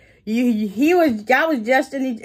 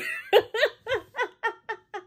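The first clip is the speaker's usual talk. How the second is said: real laugh, pitched way up; high burst of laughter; 12 semitones higher, 6 dB quieter than usual. Real, not acted. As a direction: let the laugh break out high.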